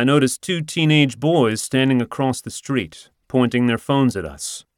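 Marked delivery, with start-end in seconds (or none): none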